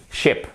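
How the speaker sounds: "Ship" with a short, lax i, pulled back; a brief, relaxed i in "Ship"; short vowel. Shown as a demonstration. Teacher models the word for boat, "Ship" with a short i.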